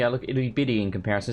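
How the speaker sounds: ordinary delivery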